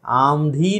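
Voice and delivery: आंधी said as "aam-dhee"; wrong m sound. Shown as a demonstration. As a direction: put an m before धी in आंधी. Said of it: "आंधी नहीं है वो".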